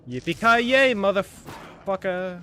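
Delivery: high, slow, very loud whoop; drawn-out action-hero yell; triumphant battle cry. Pretend. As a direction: holler the catchphrase, drawing it out. Shouts film catchphrase during combat.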